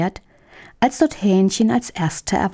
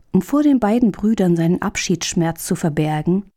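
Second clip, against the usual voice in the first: ordinary delivery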